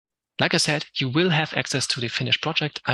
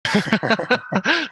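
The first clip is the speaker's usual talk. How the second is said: real burst of high, loud laughter; amused laughing fit; hearty laugh; 9 semitones higher, 5 dB louder than usual. Real, not acted. Amused by a viewer's comment.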